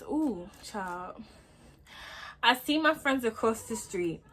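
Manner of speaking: normal talking voice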